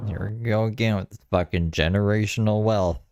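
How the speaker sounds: ordinary talk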